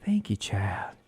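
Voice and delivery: whispered echo. Acted. Echoing the mock reply.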